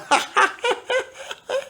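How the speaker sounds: high-pitched chuckle; high laugh. Real, not acted. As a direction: let out a high little laugh. Laughs at own joke.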